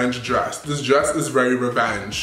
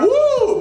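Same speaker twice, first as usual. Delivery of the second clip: a short high whoop; high mock woo; 5 dB louder than usual. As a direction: let out a high woo of excitement. The dress is not a wow.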